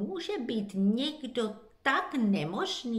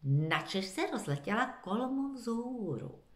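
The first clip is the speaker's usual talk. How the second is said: plain reading voice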